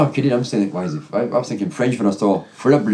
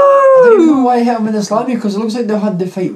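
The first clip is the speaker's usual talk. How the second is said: excited; 10 semitones higher, 5 dB louder than usual. Real, not acted. Excited wrestling chat.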